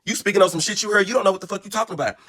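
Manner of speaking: high, heated retold rant; mock fury at her; heated telling off. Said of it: "I said".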